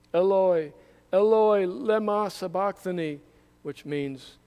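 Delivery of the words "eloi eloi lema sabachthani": loud anguished cry. A narrator's reading. Jesus on the cross crying out to God, loud anguished cry. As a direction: call out loud to heaven in anguish. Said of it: "called out with a loud voice".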